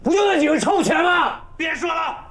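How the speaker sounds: very high, loud outburst; fed-up, explosive interruption; exasperated shout. Acted. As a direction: yell to end the argument. Outburst cutting off father's complaint.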